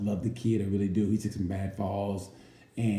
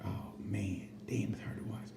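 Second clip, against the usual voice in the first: much softer, near-breathed dismay; pained hush; 11 dB quieter than usual. Retold or imitated. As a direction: wince quietly while watching. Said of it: "you're like".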